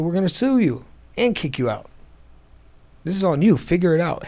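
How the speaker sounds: mock landlord threat, pitched high; retold angry threat. Property owner threatens eviction.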